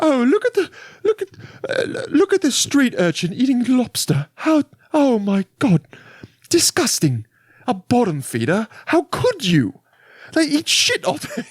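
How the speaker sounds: very high, hammed-up posh British shriek; posh British lady. Corseted lady spotting a street urchin, posh British disgust. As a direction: play an appalled British snob in falsetto. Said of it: "like, posh British person".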